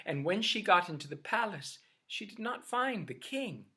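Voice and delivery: ordinary delivery, nothing marked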